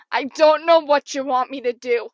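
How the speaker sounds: loud, confused call to someone across the court. Retold or imitated. Confused player calling to the coach, acted holler. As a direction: holler it helplessly, acting it out. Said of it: "calling to my coach".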